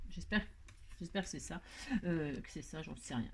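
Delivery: half under her breath, unsure; softly muttering to herself; muttered aside. Real, not acted. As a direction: mumble the doubt to yourself.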